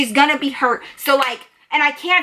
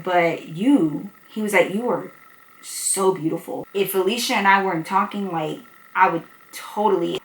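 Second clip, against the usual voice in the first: low smooth flirt; 3 semitones lower, 5 dB quieter than usual. Retold or imitated. Damien hitting on her.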